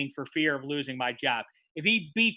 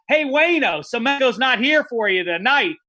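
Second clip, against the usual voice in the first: taunting call; 6 semitones higher, 5 dB louder than usual. Retold or imitated. Taunting Gretzky as he leaves.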